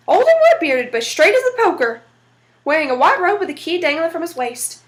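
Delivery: ordinary delivery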